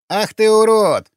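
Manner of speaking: high, loud, drawn-out shriek of outrage; outraged high-pitched yelp; angry squeal. A narrator's reading. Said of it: "взвизгнул карлик".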